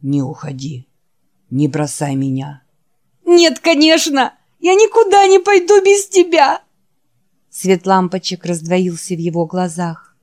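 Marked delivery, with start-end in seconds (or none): 0.0-2.6 barely has the strength to say the name; weary dying Wolf. Acted. Wounded Wolf calling his friend Jack, low and faint. Wolf's voice. 3.2-6.6 clings to him with every word; tearful promise. Acted. Jack promising the dying Wolf not to leave, high loud cry.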